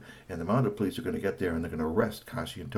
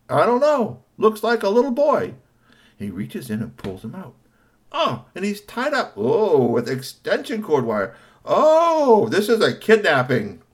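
high, loud and alarmed; alarmed discovery; 10 semitones higher, 8 dB louder than usual. Acted. Cornelius finding the tied-up robot, loud alarmed voice. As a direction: sound the alarm, it's a kidnapping.

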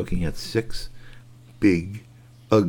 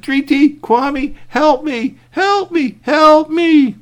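much higher and louder cry for help; yelling for help; 9 dB louder, much slower than usual. Acted. Curtis yelling down to friends, loud high cry for help. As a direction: yell down to friends in panic. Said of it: "he yelled down".

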